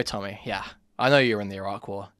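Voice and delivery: normal talking voice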